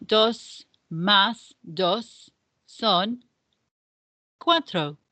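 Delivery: "dos más dos son" drawn out word by word; slow, clear sum. Shown as a demonstration. Teacher reads the first sum slowly to the class. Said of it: "número uno".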